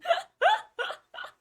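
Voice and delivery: squealing laugh; a sudden high-pitched giggle; delighted laugh at the silly cat; high burst of laughter. Real, not acted.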